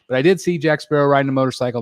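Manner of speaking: usual speaking voice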